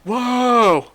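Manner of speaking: a high, loud burst of mock astonishment; jokey shocked exclamation to his co-host; surprised whoa. Real, not acted. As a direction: yelp it out like the news just hit you. Reacting to co-host's story.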